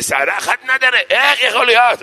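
put-on Persian old man cracking up high and loud. Old Persian man outraged over one hair, high mock Persian accent. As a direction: squeak it out in a shocked Persian accent. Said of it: "היה שם איזה פרסי אחד בן שישים".

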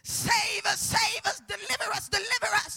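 imitating worshippers crying out; crying out to gods. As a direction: call out desperately for rescue. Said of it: "calling on their false gods".

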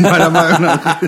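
laughing. Real, not acted.

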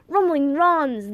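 loud, slow oath of alarm; old man's sudden loud oath; startled exclaim. Acted. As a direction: blurt it out, suddenly remembering. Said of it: "the man exclaimed".

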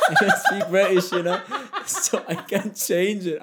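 real laughter, high and loud; cracking up between words; laughing at his own bad British accent. Real, not acted. Laughing after his British impression.

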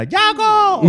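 very high, loud call of the name; yelling the clown's name. Retold or imitated. Uncle teasing the clown Yaco, shrieking his name up high and loud. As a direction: call the clown out across the yard. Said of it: "tío Carlos venía".

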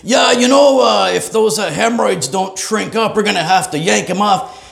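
high booming doctor. Retold or imitated. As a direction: boom it across the waiting room. Loud doctor in waiting room.

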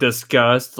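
normal talking voice

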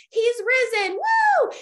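high, loud cheer ending in a whoop; mock celebration. Parents posting cute Easter pictures, mock-celebrating high cheer. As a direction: send up a gushing Easter post with a high woo.

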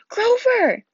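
high, loud cry of a name; alarmed shout. Acted. Boy seeing his injured friend, high alarmed shout. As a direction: call out in alarm.

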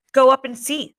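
sharp, contemptuous challenge. Acted. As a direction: sneer the dare at him. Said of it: "said Jack contemptuously".

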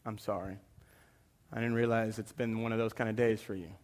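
very soft, low murmured apology; contrite apology. Retold or imitated. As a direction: humbly apologize to a wife, barely audible. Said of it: "go back at night and go".

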